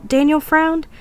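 ordinary delivery

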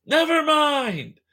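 high, loud, stretched-out shout; in-character yell. Acted. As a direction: holler it slowly with the pitch way up. Acted shouted exchange between game characters.

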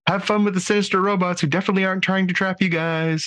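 high, fast teasing quote; a hint of Mariner's fake cheer; mock sinister cheer. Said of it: "from Mariner".

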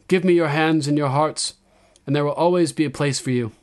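high, loud address to her people; young queen's proclamation. A narrator's reading. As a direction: proclaim it to a whole crowd.

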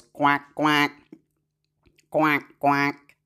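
low, lightly voiced duck quacks; a light quacking duck. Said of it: "like the mouth of a duck".

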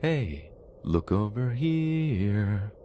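high, slow, soft singsong call; creepy sing-song voice. Retold or imitated. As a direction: lure someone in with a sweet eerie lilt. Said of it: "I heard a sing-song voice go".

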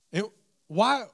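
mock exasperation. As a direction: still a little mad, playing it up. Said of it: "still be a little bit mad".

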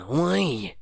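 ordinary talk

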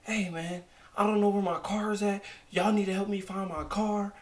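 irritated customer, hammed up; mock angry guest. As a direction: imitate a pushy guest demanding help. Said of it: "he was like".

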